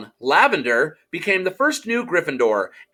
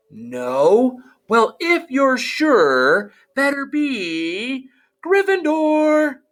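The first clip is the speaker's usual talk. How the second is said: from thoughtful to high, slow decree; the hat relents and announces; hat deciding; 10 semitones higher, much slower than usual. Acted. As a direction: draw it out, then proclaim.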